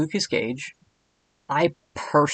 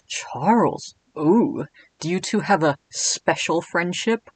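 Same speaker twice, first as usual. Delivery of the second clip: slow, sly, higher purring tease; teasing Cat King; 3 semitones higher, slower than usual. Acted. Cat King teasing Edwin about Charles, sly and slow. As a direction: coo it like a nosy flirt. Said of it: "my best Lucas Gage voice".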